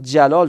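"جلال" with a clear final -e; kasra ending. Shown as a demonstration. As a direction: sound out "جلال" as jalaa-le. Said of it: "کسره گرفته".

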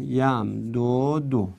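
یم دو دو drawn out at half speed; pronunciation model. Shown as a demonstration. Teacher showing it is pronounced the same way, slow and segmented.

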